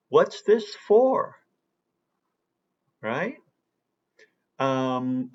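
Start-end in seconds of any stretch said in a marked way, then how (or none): 0.1-1.3 "for" stretched high and loud, slow; emphatic strong "for". Shown as a demonstration. Teacher shows students how emphasis brings back the strong form. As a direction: punch "for" at the end of "What's this for". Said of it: "an example of emphasis".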